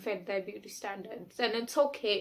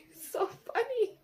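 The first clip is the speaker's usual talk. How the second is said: higher, giggling through it; laughing; 13 semitones higher than usual. Real, not acted. Telling viewers her old pain is funny now, laughing. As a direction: laugh as you say it. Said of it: "I'm laughing right now".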